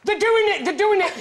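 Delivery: high, loud, breathless pointing it out; mock shocked bird-watcher spotting pigeons at it; excited gasp. Pointing at pigeons to the panel, mock shocked excitement. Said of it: "I'd love to see you".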